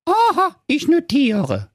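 put-on very high, squeaky girl voice; cheeky young girl. Police girl notes.

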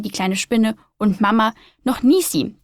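plain reading voice

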